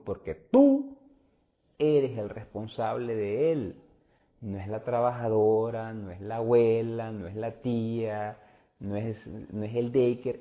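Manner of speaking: loud and slow, hammering each point; rising to a forceful, deliberate climax; emphatic preaching. Real, not acted. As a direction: pound home that you alone are responsible. Preacher drives home parental responsibility.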